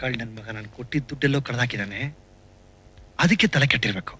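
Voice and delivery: low, soft grumble to himself; muttered aside. Acted. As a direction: say it under your breath, half to yourself.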